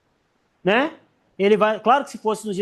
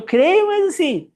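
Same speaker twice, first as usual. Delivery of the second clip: higher, slower, mock hesitant; wavering faith; 7 semitones higher, 4 dB louder, slower than usual. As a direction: shrug it out half-convinced. Mocking half-hearted belief. (fé meia-boca, em tom de deboche)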